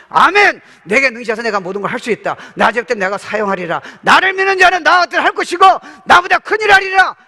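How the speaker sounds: loud, high fervent declaration; shouted amen confession. Acted. Preacher showing the believer's answer to God, shouted fervent amen. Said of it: "이렇게 하는 거예요".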